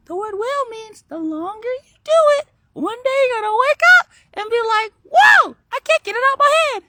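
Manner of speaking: put-on high, very loud child voice; kid voice. Kid telling what the word will means, high loud squeal. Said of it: "you just did it in the kid voice".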